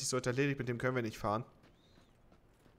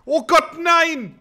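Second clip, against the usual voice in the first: panicked scream; being chased; 16 dB louder, much slower than usual. Real, not acted. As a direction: scream high and loud in panic. Zone closing in on him.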